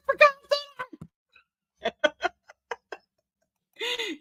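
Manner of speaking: high squeal through laughter; laughing while reading. Real, not acted. Host reads a viewer's comment, laughing too hard, high-pitched. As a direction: read it out while cracking up.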